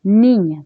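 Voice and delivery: "niña" with a forceful NI; stress on first syllable. Shown as a demonstration. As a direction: punch the NI of "niña", ÑA falling away. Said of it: "Tienes que sentir la palabra".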